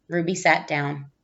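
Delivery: ordinary delivery, nothing marked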